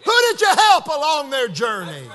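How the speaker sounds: forceful preaching. Real, not acted. Preacher urges congregation to encourage each other, forceful preaching.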